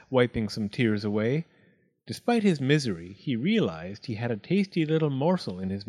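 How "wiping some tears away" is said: ordinary delivery, nothing marked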